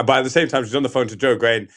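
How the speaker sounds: ordinary delivery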